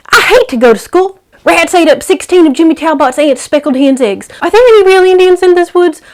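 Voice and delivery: put-on high, loud excited ten-year-old; chattering boy. Captive boy chatters to kidnappers over supper, high loud and excited. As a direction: rattle off questions like an eager kid.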